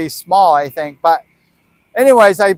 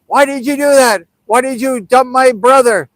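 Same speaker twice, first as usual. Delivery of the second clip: retold yell, pitched up; mock sister's yell; 5 semitones higher than usual. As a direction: imitate an angry sister yelling in protest. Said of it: "my sister yelling".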